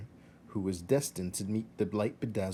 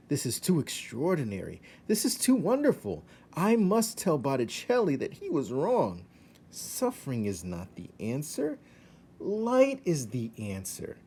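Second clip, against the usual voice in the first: rat voice rising high with wonder; rat Roscaro, enchanted; 5 semitones higher than usual. Acted. Rat seeing the banquet hall, rising high with delight. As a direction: gush over the glittering hall.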